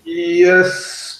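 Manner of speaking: ordinary talk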